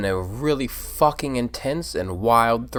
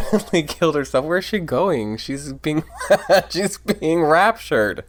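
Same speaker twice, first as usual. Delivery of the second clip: giggling through the words; laughing; 7 semitones higher, 6 dB louder than usual. Real, not acted. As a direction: laugh while saying it. Laughing at character exit.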